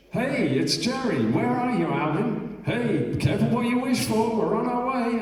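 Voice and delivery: high, loud, teasing mock American; Jerry's second message. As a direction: play the friend's cheeky warning on tape. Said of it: "another message".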